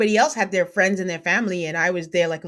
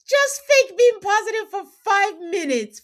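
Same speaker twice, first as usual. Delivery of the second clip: exasperated plea; 11 semitones higher than usual. Real, not acted.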